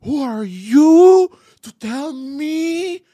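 huge, high, long-drawn bellow; retold screaming. Kid getting big as if confronting a bear, hammed-up scream. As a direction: puff yourself up and howl it. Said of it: "he starts trying to get big".

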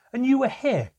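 loud, high-pitched angry yell; child's enraged outburst at a parent; furious shouting. Acted. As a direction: shout it like a blow landing. Child attacking father, shouting.

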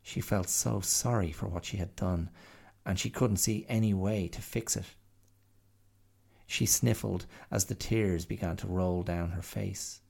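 ordinary delivery, nothing marked

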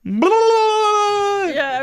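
loud, high, long imitation child's wail; mock bawling. Acting a little girl seeing her mom, loud mock wail. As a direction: burst into a hammed-up bawl. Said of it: "it was just like fountain of tears".

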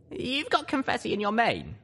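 high, light pony voice; young filly voice. Acted. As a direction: say it lightly, small voice. Lil Pip.

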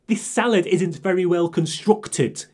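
posh diner complaining about her salad, slow and haughty; snooty customer. Retold or imitated. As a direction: look down your nose at the waiter.

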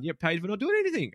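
3 throw it up high like you can't believe it; 4 hammed-up shock at paid leave; 1 mock disbelief. Retelling own shocked reaction.

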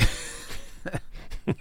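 a soft unvoiced giggle; breathy laugh. Real, not acted. Co-host giggles at his own haircut joke, breathless.

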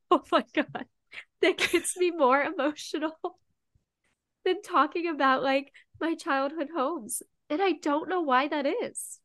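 high, loud, emotional rush through tears; fighting back tears with a laugh; choked-up, laughing at her own tears; teary and overwhelmed. Real, not acted. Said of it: "am a wreck now".

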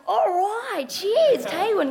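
high, loud put-on local greeting; hammed-up toast over cans; cheery locals. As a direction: imitate carefree drinkers hailing a stranger. Imitates locals drinking cans.

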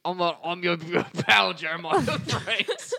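hammed-up Aussie bird voice, laughing; jokey Aussie mate voice with giggles; mock Australian. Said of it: "he's Australian".

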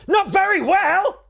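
slow, very high comic squeal; mock retort. Comedian answering the poster's English claim, high mocking squeal.

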